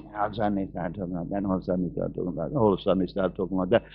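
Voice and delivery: low, soft, fast imitation of ruined vocals; damaged voice. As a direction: rattle it off in a low, feeble voice. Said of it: "As he was talking like that".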